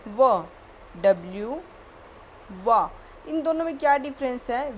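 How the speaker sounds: usual speaking voice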